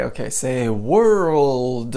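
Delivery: very slow, drawn-out instruction; patient slowed-down classroom voice; slow teacher prompt. Shown as a demonstration. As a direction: stretch every word for the student. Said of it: "And then I would try".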